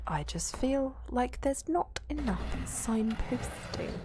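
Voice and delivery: slow, lightly put-on Welsh accent. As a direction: say it like a Welsh local, taking the piss. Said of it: "And now in Welsh".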